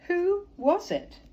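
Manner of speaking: high, slow wondering question; puzzled question. Acted. Little Beaver asking the wise beaver, slow and high. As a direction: stretch it out in wonder.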